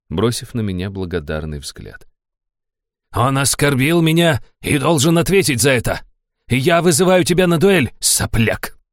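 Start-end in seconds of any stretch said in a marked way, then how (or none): 3.1-8.8 furious challenge, higher and louder; angry shout. A narrator's reading. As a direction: scream the challenge. Baron challenges to duel.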